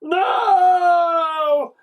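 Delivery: high, loud shouted no; a panicked yell; shouting. A narrator's reading. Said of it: "shouted the pair".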